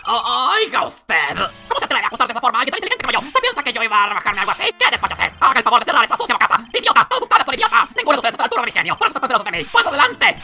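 loud, fast, frantic villain rant; furious rant. Acted. Unmasked blackmailer to the whole court, furious loud rant. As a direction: lose it completely, screaming at everyone.

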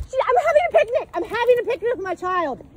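indignant yelling at police. Real, not acted. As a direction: yell it out in real outrage. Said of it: "I would stop yelling".

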